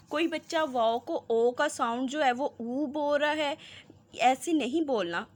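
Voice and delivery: ordinary delivery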